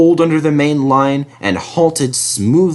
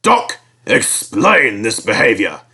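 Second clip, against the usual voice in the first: slow, stern gentleman's voice; a stern railway boss; stern controller; 3 semitones lower than usual. A narrator's reading. Fat Controller voiced in story.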